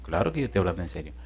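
low, soft, quick reply; understated, fast, dropped in pitch; matter-of-fact reassurance. Retold or imitated. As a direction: answer the excited question casually and quietly. Pastor calmly answers excited man.

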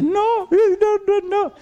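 very high, crying denials; a son crying to his mother on the phone, high and sobbing. Retold or imitated. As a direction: sob the no's out, voice way up.

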